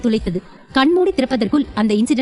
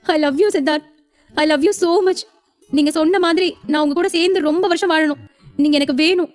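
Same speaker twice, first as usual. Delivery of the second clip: higher, lightly tearful loving wife; wife clinging to her husband, mildly tearful; tearful confession; 5 semitones higher than usual. Acted. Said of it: "கட்டிக்கொண்டு அழுதாள்".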